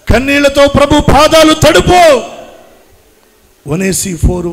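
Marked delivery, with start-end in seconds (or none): 0.1-2.0 louder and higher, crying out the plea; fervent cry. Acted. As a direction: lift it into a full preaching cry. Preacher repeats plea softly then loudly. (బిగ్గరగా, ఉద్వేగంతో కేకలా చెప్పే వేడుకోలు)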